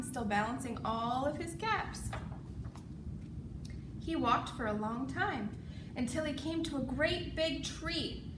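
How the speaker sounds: ordinary delivery, nothing marked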